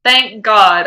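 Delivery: ordinary talk, nothing marked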